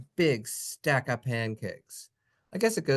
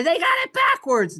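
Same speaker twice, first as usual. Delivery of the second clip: much higher squeaky pupil voice; mock pupil; 6 dB louder than usual. Teacher mimics pupils protesting the spelling. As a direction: play the confused kid.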